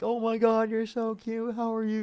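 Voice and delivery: put-on very high cooing voice for a baby; baby talk. Shown as a demonstration. Showing how you talk to a cute baby, high cooing. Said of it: "you change your voice".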